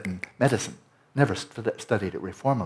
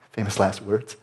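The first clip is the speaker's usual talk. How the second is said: normal talking voice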